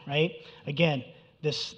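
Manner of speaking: ordinary delivery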